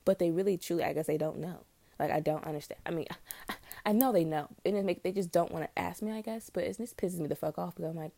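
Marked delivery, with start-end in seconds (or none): none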